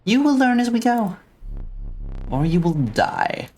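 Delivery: high, menacing, slowing at the end; unsettling creepy voice. Acted. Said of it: "I'm trying to do an unsettling, creepy voice".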